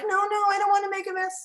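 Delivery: very high, loud mock child wail; pretending upset child. Teacher plays upset boy for him, high mock wailing. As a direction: wail like an overwhelmed little boy. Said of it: "I pretended to be him".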